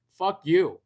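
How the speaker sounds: loud, sharp mock curse; a loud, joking mock curse; loud mock insult. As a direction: bark it like a fake insult. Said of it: "they're like".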